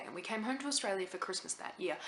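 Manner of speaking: normal talking voice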